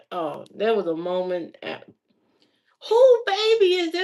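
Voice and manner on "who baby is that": mock yell. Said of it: "they yelling".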